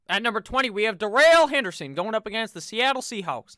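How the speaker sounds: lightly put-on Western cowboy accent; a hint of a Western accent announcing the pick. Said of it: "I gave Darrell Henderson a, uh, Western accent".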